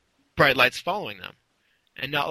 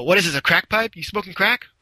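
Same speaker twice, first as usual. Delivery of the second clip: higher-pitched mock interrogation; retold confrontation; 5 semitones higher than usual. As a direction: confront him like an imitated cop. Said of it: "she confronts Swamp Thing about it".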